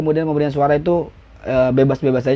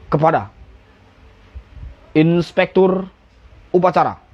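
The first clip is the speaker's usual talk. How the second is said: pemimpin upacara. Shown as a demonstration. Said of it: "pemimpin upacaranya".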